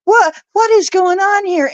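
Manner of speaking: high, startled what; mock bewildered reader; baffled exclaim. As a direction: act out her confusion. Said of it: "she's just like".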